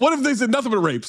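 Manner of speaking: high, disbelieving question; incredulous. Real, not acted. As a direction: ask it as if shocked.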